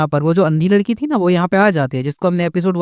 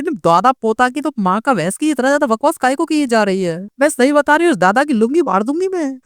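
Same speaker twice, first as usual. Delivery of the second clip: furious rant; 6 semitones higher than usual. Acted. Furious girl threatens grandfather.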